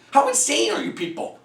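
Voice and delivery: ordinary talk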